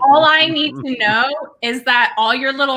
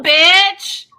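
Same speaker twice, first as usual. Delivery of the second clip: sassy punchline; 5 semitones higher, 5 dB louder than usual. Acted. Punchline after mock spell.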